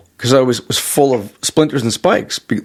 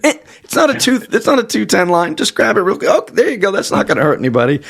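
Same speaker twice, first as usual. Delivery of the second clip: much higher, breezy mock of his father; imitating dad; 6 semitones higher than usual. Son mimics dad shrugging off live wire, high breezy voice. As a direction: brush the danger off cheerfully. Voicing dad's dismissive reassurance.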